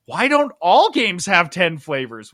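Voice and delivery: ordinary talk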